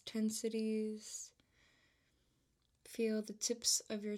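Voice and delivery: calm, unhurried voice with long pauses between phrases; measured, even-toned meditation guide; guided meditation. Real, not acted. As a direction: ease the listener into relaxation, leaving room to breathe.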